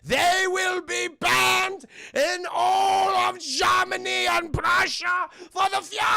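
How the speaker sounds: high, slow, shouted mock German accent; ranting German accent. Burgermeister banning all toys, furious German-accented rant. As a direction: rage like a hammed-up German tyrant. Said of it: "he's speaking German and stuff".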